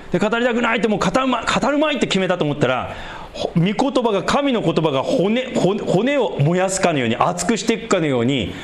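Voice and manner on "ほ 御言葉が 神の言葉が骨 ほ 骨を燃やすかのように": ordinary talk, nothing marked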